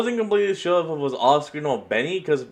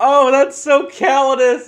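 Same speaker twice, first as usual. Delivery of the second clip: mock outrage; 5 semitones higher, 7 dB louder than usual. Real, not acted. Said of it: "listener outraged joke".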